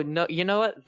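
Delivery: ordinary talk, nothing marked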